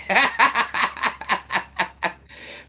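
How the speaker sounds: breathy embarrassed giggle; giggling at her own bad cooking; airy laugh. Real, not acted. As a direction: laugh it off, sheepish.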